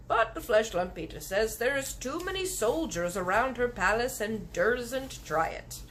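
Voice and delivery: giant voice. Acted. Giant explaining to the girl, gentle.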